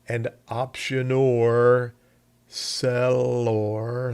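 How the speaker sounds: sellor stretched with a long o and r; drawled sellor. Shown as a demonstration. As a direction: say sellor as SELL-orr, slow. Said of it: "That's the way I like to remember 'em".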